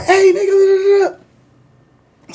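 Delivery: high, slow mock shout fading away; mimicked drive-by holler, fading out; imitated calling out. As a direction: call out high, then trail into near-silent babble.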